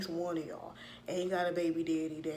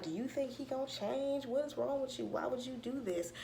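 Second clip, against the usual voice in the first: higher-pitched, imitated scolding; mock pissed mama; 7 semitones higher than usual. Mama questioning her daughter about the boyfriend, mock exasperated. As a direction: act out a mother demanding answers. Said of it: "And Mama pissed".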